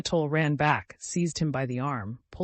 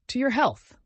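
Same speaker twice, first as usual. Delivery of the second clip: higher, louder driver's toast; hearty toast; 10 semitones higher, 5 dB louder than usual. A narrator's reading. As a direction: lift the glass and call it out.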